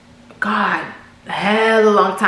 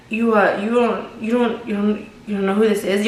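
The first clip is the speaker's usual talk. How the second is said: nervous stutter. Retold or imitated. Caller stuttering to Sophia on the phone, imitated nervous stutter. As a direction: imitate his halting, stumbling stutter. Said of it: "Y'all, he was stuttering".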